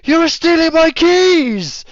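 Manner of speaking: high, slow, outraged squeal; indignant yell. Acted. Veteran squeals at the thief, high and slow. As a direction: drag it out high and wounded. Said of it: "He's gonna be like".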